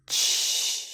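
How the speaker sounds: Ch drawn out long, sliding toward sh; stretched ch. Shown as a demonstration. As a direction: hold the ch until it turns to sh. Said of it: "try pronouncing the ch sound, stretching it".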